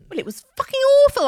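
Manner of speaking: loud, fast cry of real horror; outraged outburst. Real, not acted. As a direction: blurt it out in real dismay. Outburst over the sad film.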